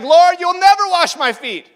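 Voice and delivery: high, loud refusal; vehement protest. Acted. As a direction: cry out in shocked objection. Peter loudly refuses Jesus.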